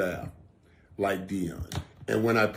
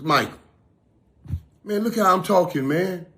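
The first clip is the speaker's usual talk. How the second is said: higher and louder, thrilled his voice is back; excited friend; 8 semitones higher, 5 dB louder than usual. Retold or imitated. Retelling his friend's happy words, high and loud. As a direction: imitate his buddy crowing with delight. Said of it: "he was sounding like this".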